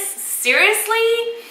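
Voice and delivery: long, high, disbelieving question; retold incredulous reaction. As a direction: stretch it out and lift the pitch in disbelief. Reacting to having to walk back.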